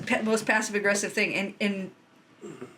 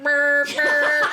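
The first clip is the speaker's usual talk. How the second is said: loud mock horn honk; honking noise; 7 semitones higher, 7 dB louder than usual. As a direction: blare it like a car horn. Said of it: "I'd be like".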